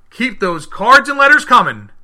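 high, loud, slow sign-off call; big sign-off. Acted. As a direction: belt out the farewell to the audience. Show sign-off to listeners.

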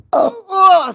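high, loud startled "oh, oh"; jolted by a sudden noise, voice jumping up. Real, not acted. Startled by a screaming noise outside.

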